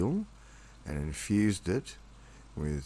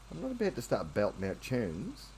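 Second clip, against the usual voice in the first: cooing pet talk; 5 semitones higher than usual. Real, not acted. Coos to cat.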